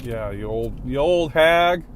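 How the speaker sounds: shouting an insult at the driver who flipped him off; shouted insult. Real, not acted. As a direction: spit the insult out.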